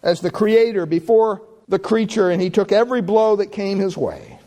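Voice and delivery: high, ringing preacher's cadence, lifted on every phrase; full-force preaching. Acted. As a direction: preach it out over the congregation. Preaching climax over the passion.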